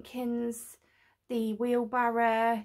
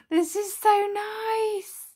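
awed; 10 semitones higher, 6 dB louder, much slower than usual. Real, not acted. Admiring the lit model.

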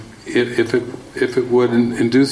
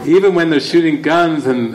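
normal talking voice

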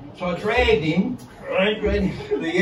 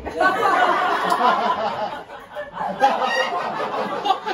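laughs at own joke, pitch up high; a long rising cackle; real belly laugh; 10 semitones higher than usual. Real, not acted.

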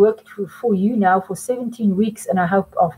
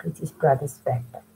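whisper; 5 semitones lower, 6 dB quieter than usual. Shown as a demonstration. Teacher whispers answer.

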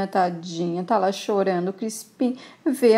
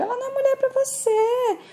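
voz de personagem; 13 semitones higher than usual. Acted. Pai aconselha filho na novela.

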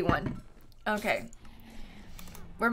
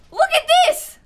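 high, loud outburst; exasperated shout; 11 semitones higher, 5 dB louder than usual. Real, not acted. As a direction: cry out in sheer disbelief. Exasperated by chaos in hole.

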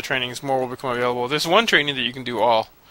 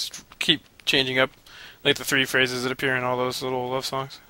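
usual speaking voice

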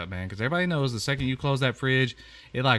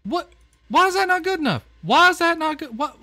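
much higher, louder, fast outburst; exasperated shout; 7 dB louder than usual. Real, not acted. Fed-up gamer yells at the picky guest, exasperated. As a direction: throw up your hands and yell it.

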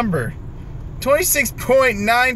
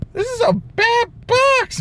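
squeaky complaint. Real, not acted. Frustrated at bad pulls.

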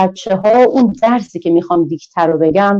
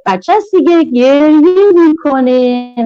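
playful high voice; 7 semitones higher, 3 dB louder, slower than usual. Acted. Playful mnemonic about crying baby.

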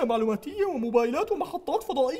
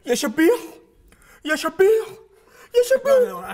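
louder, building shout at the thug; angry yell; 5 semitones higher, 6 dB louder than usual. Acted. Leader yelling at his servant, angry shout. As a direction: yell it, rising each time.

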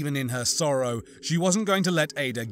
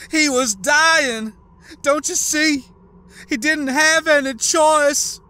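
much higher, very loud, slow desperate shout; pleading shout; 14 semitones higher, 10 dB louder, slower than usual. Acted. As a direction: scream for understanding. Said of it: "voice raised into a shout ... pleading shout".

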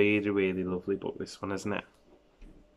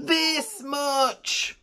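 little hare; 11 semitones higher than usual. Acted. Little hare stretching arms wide, high proud small voice.

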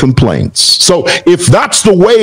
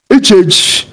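amateur mock Nigerian accent; attempted Nigerian accent; 3 semitones higher than usual. Broadcaster quoting the Nigerian commentator he disputes, attempted Nigerian accent. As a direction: imitate a rival with a rough Nigerian accent. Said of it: "how to do a Nigerian accent".

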